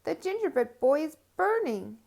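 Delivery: high, loud alarm that the cookie burns; old woman calling out in alarm; alarmed cry. Acted. Woman sees the burning cookie.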